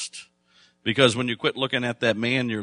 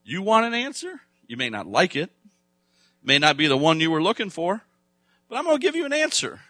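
high, eager divine reply, speeding up at the end; God replying; 6 semitones higher, 3 dB louder than usual. Retold or imitated. Preacher voicing God excited by a prayer, high and eager. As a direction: answer like someone thrilled to be asked.

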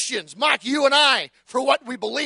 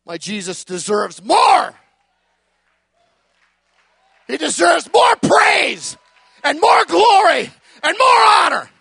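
preacher building to a loud peak; fervent declaration; 4 semitones higher, 8 dB louder than usual. Acted. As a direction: lean into every more.

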